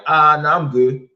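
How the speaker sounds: high, loud nah-I'm-good; loud nervous refusal. Retold or imitated. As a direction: back off loudly with a laugh in it. Refusing to touch her tattoo.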